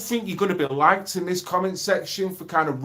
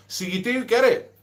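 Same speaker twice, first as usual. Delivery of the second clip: usual speaking voice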